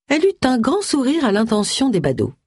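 usual speaking voice

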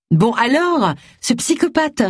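irritated, rising high on psychopathe; mildly exasperated prodding; annoyed insistence. A narrator's reading. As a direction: press the point, fed up. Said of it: "insista Agatha agacée".

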